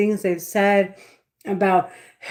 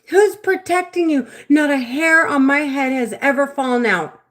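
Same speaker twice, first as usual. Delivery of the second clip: higher, louder contemptuous challenge; scornful retort; 6 semitones higher, 3 dB louder than usual. Retold or imitated. The voice taunting her about her hair, scornful and higher. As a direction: throw the question back with scorn. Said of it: "She's like".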